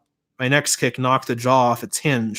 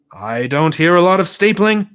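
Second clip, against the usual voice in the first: louder, dry rebuke; loud scolding dad; 5 semitones higher, 6 dB louder than usual. A narrator's reading. Dad scolding Bryce from his desk, loud dry rebuke. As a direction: call out the slacking. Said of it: "my dad shouted".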